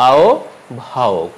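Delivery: আহ্বায়ক drawn out as "ao... bhayok"; slow correct pronunciation. Shown as a demonstration. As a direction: slowly split আহ্বায়ক before the bh. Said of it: "উচ্চারণ হবে".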